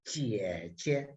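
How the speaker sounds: "jie jie" both pitched very low and soft; low third tone. Shown as a demonstration. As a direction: repeat "jie jie" deep in the third tone. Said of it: "And then repeat it".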